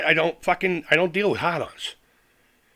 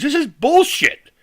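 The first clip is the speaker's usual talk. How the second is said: higher and slow, each word pressed; slow angry verdict; 8 semitones higher, slower than usual. Real, not acted. Ranting at the community, slow angry disgust. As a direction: spit it out with disgust.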